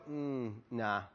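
low, quiet, noncommittal mumble; mock uninterested brush-off; reluctant refusal. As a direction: decline with a soft shrug. Acts out man declining job.

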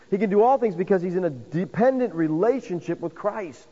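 fervent sermon climax; preaching at full force. Acted. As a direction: drive the point home from the pulpit.

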